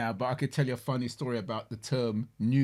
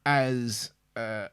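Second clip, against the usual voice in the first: normal talking voice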